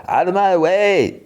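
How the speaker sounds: pushy princess, high and drawn out; mock high-pitched demand; Lumpy Space Princess. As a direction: shove them aside with a slow, high whine. Lumpy Space Princess shoving, acted.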